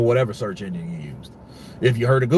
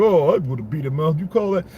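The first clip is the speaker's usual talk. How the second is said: pompous boxer impression; 5 semitones higher, 3 dB louder than usual. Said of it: "you talk like a pompous asshole after".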